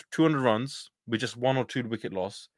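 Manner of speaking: usual speaking voice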